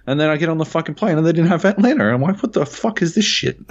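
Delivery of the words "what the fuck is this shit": retold outrage. Retold outrage at missing show.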